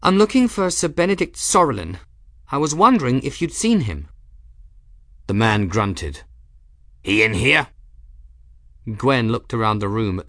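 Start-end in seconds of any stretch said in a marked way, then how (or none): 0.0-4.1 put-on higher, louder young woman's voice; polite, a bit tense inquiry; young heroine. A narrator's reading. 7.0-7.7 short gruff sarcastic question; gruff engineer. A narrator's reading.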